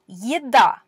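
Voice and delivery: "еда" starts with a Y glide before the e; soft ye sound. Shown as a demonstration. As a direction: clear teacher demo of "еда" with a soft ye. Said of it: "the quote-unquote soft version".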